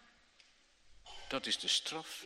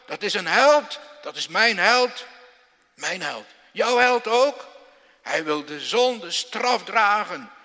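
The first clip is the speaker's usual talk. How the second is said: loud, rising hellfire preaching voice; a preacher thundering about his hero; full-force preaching; 8 semitones higher, 14 dB louder than usual. Acted. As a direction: proclaim it to the whole church.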